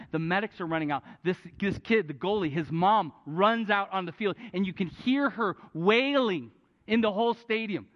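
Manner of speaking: ordinary talk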